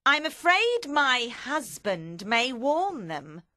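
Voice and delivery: slow ominous pronouncement. Acted. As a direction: draw it out with foreboding, loud and high. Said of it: "Madame Defarge said ominously".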